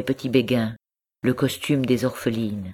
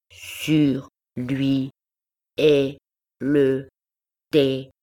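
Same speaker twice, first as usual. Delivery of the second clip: slow syllable-by-syllable reading; lightly voiced orphan girl, halting and serious; grave child reading; much slower than usual. A narrator's reading. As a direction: read it out solemnly like a schoolgirl sounding out words. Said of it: "l'enfant continuait sa lecture d'un air grave".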